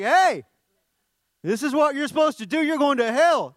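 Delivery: loud, high-pitched hellfire bullhorn holler; fiery preacher shout. Acted. Mock preacher warning sinners through a bullhorn, shouting. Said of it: "sort of my version of, of preaching".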